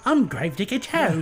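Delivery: badly faked creepy British character. Gravedigger character introducing himself to visitors, British voice. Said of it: "that's not gonna work".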